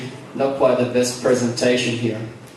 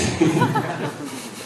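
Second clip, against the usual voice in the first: a short high burst of real laughter; 12 semitones higher, 5 dB quieter than usual. Real, not acted. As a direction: laugh out at the end of the joke. Laughing at his own joke.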